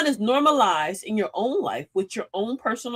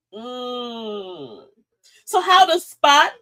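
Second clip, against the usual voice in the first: ordinary talk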